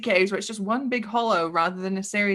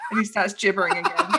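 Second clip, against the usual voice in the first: usual speaking voice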